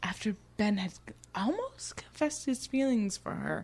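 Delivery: hushed, then rising high; dramatic whisper. Pretend. Retelling the cliffhanger to the co-host, mock dramatic whisper. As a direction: build the suspense from whisper to high.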